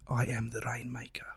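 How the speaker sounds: hushed, breath-only film quote; dramatic whisper. Acted. Guest quotes remembered film line, dramatic whisper. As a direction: whisper it with mystic weight.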